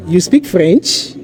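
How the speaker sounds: slow, "French" rising at the end; question intonation. Shown as a demonstration. Teacher modelling a casual question for learners, question intonation. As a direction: raise the voice on "French" to make it a question. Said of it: "raise the, your voice pitch at the end".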